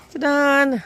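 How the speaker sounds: bright loud ta-da; excited reveal. Real, not acted. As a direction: present it with a flourish. Shows off picked fruit.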